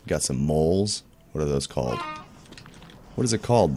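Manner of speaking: ordinary talk, nothing marked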